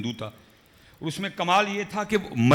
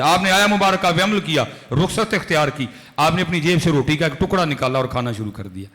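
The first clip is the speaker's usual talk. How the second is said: ordinary delivery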